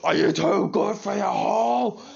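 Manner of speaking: high, loud, slow furious taunt; imitated angry yell. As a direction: yell it at the golf ball, raging. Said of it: "and he says".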